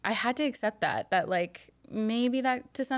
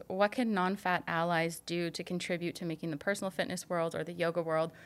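ordinary talk

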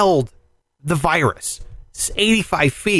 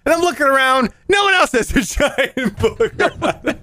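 can't stop laughing while telling it; 7 semitones higher than usual. Real, not acted. Laughing through the story.

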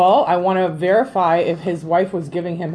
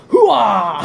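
loud gung-ho soldier. Retold or imitated. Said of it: "sounds like I'm part of the Navy".

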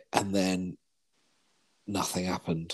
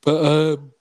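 talking through a yawn; 6 semitones higher, 7 dB louder than usual. Real, not acted. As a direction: let a yawn stretch the words. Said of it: "that was me yawning".

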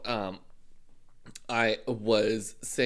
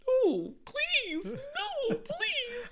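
put-on high squeaky child begging no please; whiny kid pleading; much slower than usual. Uncle mimics nephew begging not to be punished, squeaky high plea. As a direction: beg like a little kid in trouble. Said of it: "will say".

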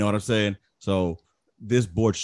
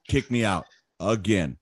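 normal talking voice

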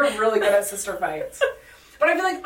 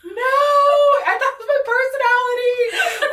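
high, loud, laughing mock-sob; mock crying wail; 14 semitones higher, 5 dB louder than usual. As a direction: wail it like a hurt kid, half laughing. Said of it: "and I started crying".

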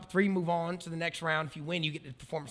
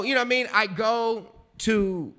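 normal talking voice

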